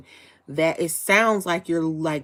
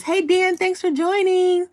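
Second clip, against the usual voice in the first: much higher, louder hello to a newcomer; excited greeting; 12 semitones higher, 5 dB louder than usual. Real, not acted. Streamer greeting viewer Dan as he joins, excited and high.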